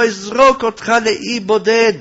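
high, forceful menace; acted threat. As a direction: threaten loudly in her role. Woman threatens Joseph with exile.

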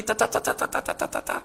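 rapid "ta-ta-ta" fading to voiceless; imitated machine-gun fire. Survivor showing automatic fire at him, imitated gun bursts.

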